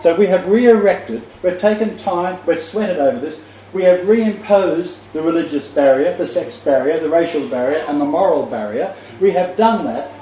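high-pitched, insistent listing; emphatic preaching. Real, not acted. Preacher charges believers with rebuilding barriers, emphatic preaching. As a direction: hammer each charge home from the pulpit.

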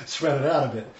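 amused, laughing as you talk. Real, not acted. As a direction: laugh breaking into the words. Laughs at his own aside.

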